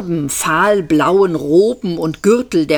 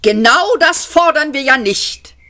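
high, loud irritated correction; angry shout; 9 semitones higher, 4 dB louder than usual. A narrator's reading. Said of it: "schrie Magik Weiß ärgerlich". (hoher, lauter, verärgerter Ruf)

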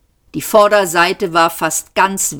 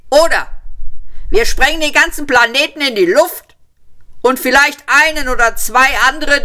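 high, louder shouted speech throughout; shouting policeman; 8 semitones higher, 3 dB louder than usual. A narrator's reading. Policeman shouting his absurd ultimatum, loud. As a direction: shout the whole speech across the room. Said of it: "schrie der Polizist".

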